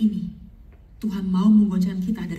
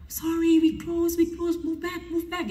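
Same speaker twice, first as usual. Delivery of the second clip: flight attendant; 9 semitones higher, slower than usual. Retold or imitated. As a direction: turn a passenger away in a put-on high voice. Voicing crew turning passenger away.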